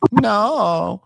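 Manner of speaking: flat-out refuse it; mock defiant no. Mysaria refusing.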